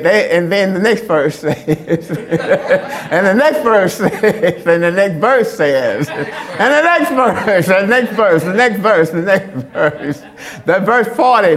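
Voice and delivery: real laughter breaking through; laughing while talking. Real, not acted. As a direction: keep losing the line to laughter. Cracking up after own demonstration.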